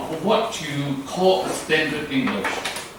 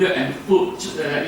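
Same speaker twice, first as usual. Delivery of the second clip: usual speaking voice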